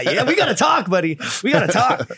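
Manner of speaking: high-pitched, laughing call-out; amused confrontation. Retold or imitated. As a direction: pounce on the buddy with amused urgency. Acts out confronting the rumor teller.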